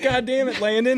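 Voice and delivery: high, loud mock scolding; exasperated exclamation. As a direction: cry out half laughing at him. Exasperated at friend's extra shot.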